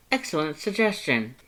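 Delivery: high approving voice; eager Papyrus. Acted. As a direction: agree brightly up high. Papyrus agrees.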